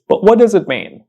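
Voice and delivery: usual speaking voice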